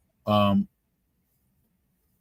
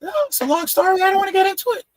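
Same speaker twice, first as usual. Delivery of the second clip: very high squeaky voice; high-pitched little guy; faster than usual. Retold or imitated. Tiny-headed man telling the bartender in a high squeak. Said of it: "The guy has a really high-pitched voice".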